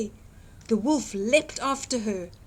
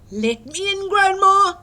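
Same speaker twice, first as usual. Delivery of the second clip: louder, higher call to Grandma; wolf calling; 9 semitones higher, 10 dB louder than usual. Acted. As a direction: call sweetly through the door. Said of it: "he called".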